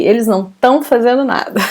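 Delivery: "tão" for estão, first syllable dropped; fast casual spoken Portuguese with "tão"; colloquial reduced form. Shown as a demonstration. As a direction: say "não tão" quickly, with no "is" before "tão". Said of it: "But we would say".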